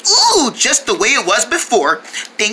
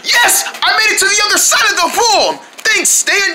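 triumphant cheer; 12 semitones higher than usual. Acted. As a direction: celebrate making it across. Winning the video game.

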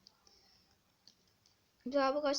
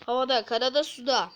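louder proclaiming; 2 semitones higher, 8 dB louder than usual. Acted. Player rhyming about attacks, louder proclaiming. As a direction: declaim it loud like a slogan. Repeats line with gusto.

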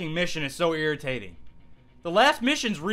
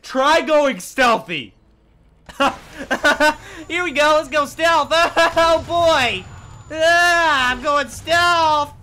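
manic mock cheer; 9 semitones higher, 6 dB louder, slower than usual. Real, not acted. Giddy mock stealth.

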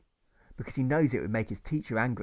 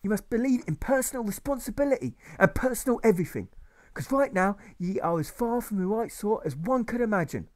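a pompous master berating his class; ranting old schoolmaster; 10 semitones higher, 4 dB louder than usual. Acted. Old teacher berating the pupils, ranting high voice.

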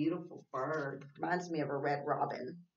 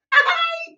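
short squealing laugh, much higher and louder; high delighted laugh; 17 dB louder than usual. Real, not acted. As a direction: burst out laughing at the page. Laughs at teacher page.

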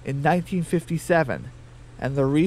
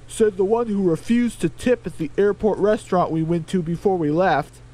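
higher, altered grumbling chicken voice; 4 semitones higher, 3 dB louder than usual. Acted. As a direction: whine a bit higher about the airline. Larry voiced in story.

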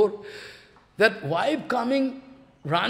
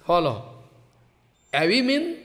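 ordinary talk

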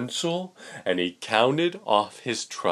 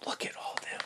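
secretive whisper; 13 dB quieter, much faster than usual. Real, not acted. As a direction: lean in and whisper. Pointing out the picture.

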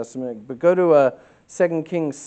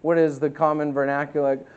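ordinary delivery

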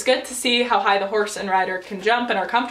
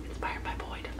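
hushed, voiceless whisper; whispered aside; 15 dB quieter than usual. Real, not acted. Rider crediting her idol for going gloveless, whispered. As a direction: whisper it like a sly confession.

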